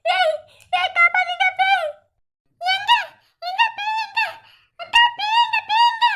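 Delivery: high, frantic shouts of ghost; hammed-up panic, pitch climbing; panicked cries. Girl spotting a ghost and yelling in mock panic.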